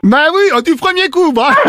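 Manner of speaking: ordinary talk, nothing marked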